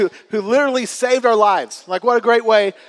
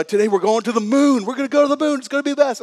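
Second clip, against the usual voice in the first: put-on higher pitched, overexcited dad teasing the kids; mock hype; 6 semitones higher, 3 dB louder, faster than usual. Pastor teasing his gullible kids with a mock excited promise. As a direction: sell the trip to the moon like it's real.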